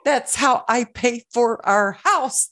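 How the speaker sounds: high-pitched, drawn-out mock meltdown; retold freak-out. Host retells freaking out to her husband over no internet, mock meltdown. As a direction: wail it word by word, hammed up. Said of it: "I was freaking out".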